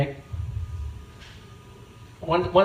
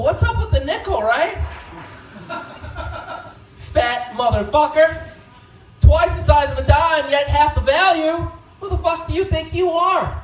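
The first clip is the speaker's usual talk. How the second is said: mock heckler; 6 semitones higher than usual. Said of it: "bad impression of me".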